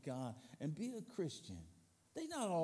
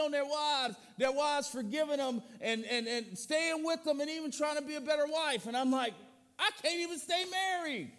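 high, loud preacher's cry; fiery preaching; 10 semitones higher, 7 dB louder than usual. Acted. Pastor preaching hard to the congregation, loud and high. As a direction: preach it out at full force.

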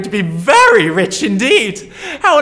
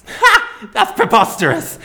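scoffing laugh. Acted. Mocking dismissal, laughing.

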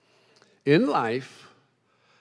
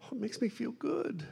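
higher, wary question; anxious waitress; 10 semitones higher, 9 dB quieter than usual. Retold or imitated. The waitress asking the preacher, higher and wary. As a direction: she suspects something odd.

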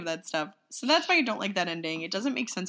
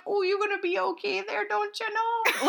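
put-on Fargo accent; 12 semitones higher than usual. Quoting the bat to her co-host, in a high Fargo accent. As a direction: stretch out the Minnesota "don't you know". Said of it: "He's like".

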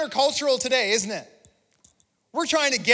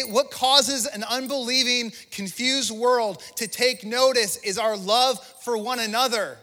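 normal talking voice

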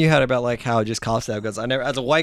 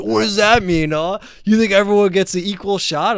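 higher, louder mocking challenge; mocking conservative relative; 7 semitones higher, 4 dB louder than usual. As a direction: throw it at him like a scoffing uncle. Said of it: "my Italian conservative family gives me shit".

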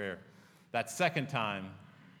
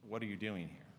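soft, low question, as God retold; gentle probing; 7 semitones lower, 11 dB quieter than usual. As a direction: lower the voice and ask it quietly. Retelling God's probing question.

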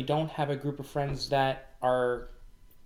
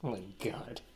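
whispered; 4 semitones lower, 11 dB quieter than usual. Real, not acted. Reacting to the joke.

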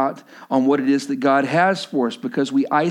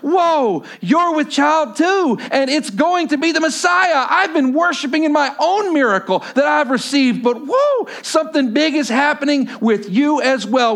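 excited; 12 semitones higher, 4 dB louder than usual. Retold or imitated. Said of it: "and Elizabeth goes".

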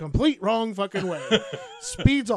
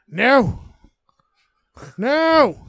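loud retold yell of no; neighbor yelling no; 4 semitones higher, 10 dB louder than usual. Old neighbor yelling no at his fence, loud yell. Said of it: "he just starts yelling".

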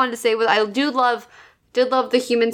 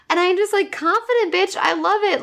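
mock gushing Marnie; 7 semitones higher than usual. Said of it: "And she's like".

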